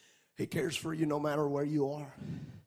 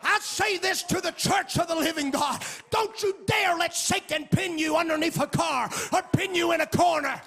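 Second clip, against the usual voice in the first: loud, high, sing-song preaching cadence; full-force preaching; 13 semitones higher, 9 dB louder than usual. Acted. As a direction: preach it out, rising and ringing over the music. Preaching climax over music.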